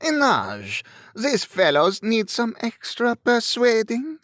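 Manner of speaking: put-on high-pitched French-accented call. A narrator's reading. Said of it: "Raising his voice, he called".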